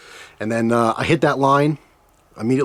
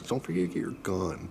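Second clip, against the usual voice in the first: much softer, mock clerk reminder; quiet imitation of the lady; 3 semitones lower, 13 dB quieter than usual. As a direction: repeat "Don't forget to get your gun." softly in her voice. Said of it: "She says".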